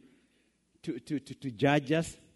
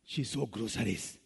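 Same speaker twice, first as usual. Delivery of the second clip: ordinary talk, nothing marked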